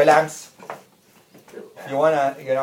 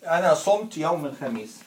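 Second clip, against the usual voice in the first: أنا صمت يوم الخميس run together fast as one phrase; أنا صمت يوم الخميس at quick natural conversational speed; fluent full sentence; much faster than usual. Shown as a demonstration.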